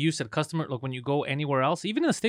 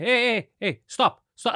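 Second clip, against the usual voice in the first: higher, louder mock protest at the waiter; retold angry stop; 7 semitones higher, 3 dB louder, much slower than usual. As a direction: call out hey, stop, in a mock temper. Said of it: "I would get super mad".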